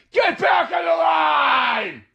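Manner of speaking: high, drawn-out screamed order; yelling TSA agent. Retold or imitated. Airport worker herding travelers back into line, yelling. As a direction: scream it slowly at the whole line. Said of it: "just yelling at us".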